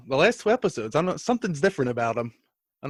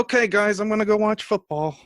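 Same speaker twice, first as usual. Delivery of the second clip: imitating himself; 7 semitones higher than usual. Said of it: "And then I go".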